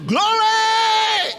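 high, ringing shout of praise; crying out. Acted. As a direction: shout it out and hold the last sound. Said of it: "in his temple all cry".

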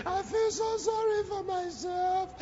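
high, slow mock self-pity; self-pitying whine. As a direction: whine like a sorry victim. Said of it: "They, they didn't go".

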